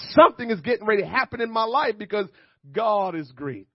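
loud, building pulpit exhortation; full-force preaching. Real, not acted. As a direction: stir up the congregation with mounting urgency.

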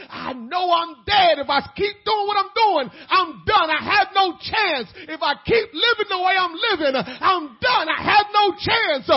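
loud, high, slow cries; desperate confession. Retold or imitated. Voicing the hopeless leper, loud and high. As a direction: shout the despair out. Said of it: "courage to say".

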